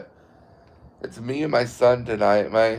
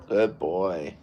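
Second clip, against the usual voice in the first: soft praise to the dog; 5 semitones lower, 6 dB quieter than usual. Real, not acted. Owner calming his dog, soft and low. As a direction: praise the dog gently and quietly.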